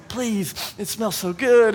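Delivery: imitated kiddie whine for candy; begging kids. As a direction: draw it out like a child begging. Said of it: "they're just asking".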